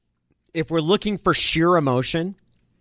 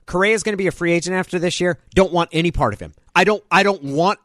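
ordinary delivery

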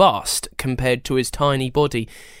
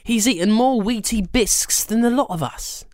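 put-on sharp girl voice; 9 semitones higher than usual. Ella voiced in story.

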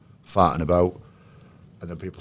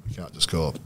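ordinary delivery

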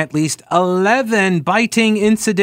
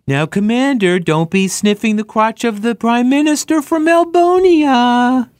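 high, drawn-out coddling dog-owner voice; mock baby-talk scolding; 7 semitones higher than usual.

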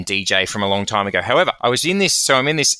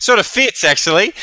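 amused; 11 semitones higher, 5 dB louder than usual. Real, not acted. Laughing reply to joke.

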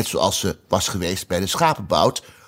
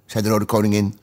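plain reading voice

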